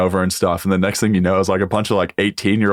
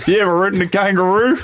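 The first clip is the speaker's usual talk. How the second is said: high, put-on Australian voice; playful exaggerated Aussie chat-up; mock Aussie accent; 12 semitones higher than usual. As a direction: pitch it way up, hamming up the Aussie. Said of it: "I can do full Aussie".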